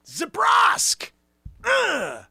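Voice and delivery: high shouted name call; Zabrosk shout. Acted. Sidekick bellowing his own name to the crowd. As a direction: yell your own name with glee.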